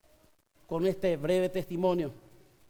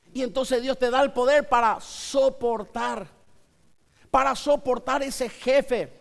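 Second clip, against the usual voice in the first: raised, driving sermon voice; fervent preaching; 5 semitones higher, 4 dB louder than usual. Acted. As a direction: preach it out to the congregation. Preacher building intensity.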